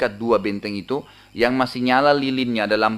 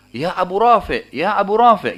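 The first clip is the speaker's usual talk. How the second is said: raised, drawn-out call to someone; mild retold call; 6 semitones higher, 3 dB louder than usual. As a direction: call out a name, stretched and lifted. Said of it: "dengan suara".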